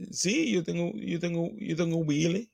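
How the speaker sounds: high-pitched mock Spanish-speaker voice; attempted Latin accent. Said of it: "based on the accent that I'm attempting".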